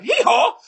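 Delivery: high, loud mock donkey bray. Mocking caller as a donkey.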